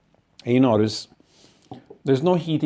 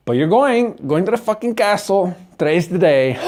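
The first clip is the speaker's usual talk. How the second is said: high, eager mock-giddy voice; a hammed-up rush of thrill before the big day; excited; 6 semitones higher, 3 dB louder than usual. Excited anticipation retold.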